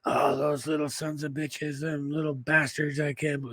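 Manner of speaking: telling how his sleepless roommate complained, in slow grumbling mimicry; griping roommate. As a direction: mimic an exhausted buddy griping. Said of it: "he was bitching and moaning".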